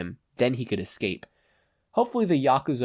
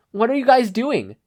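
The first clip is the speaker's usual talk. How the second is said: alarmed; 12 semitones higher, 7 dB louder than usual. A narrator's reading. Being dragged off, alarmed.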